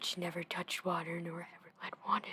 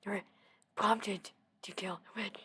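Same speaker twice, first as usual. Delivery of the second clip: ordinary delivery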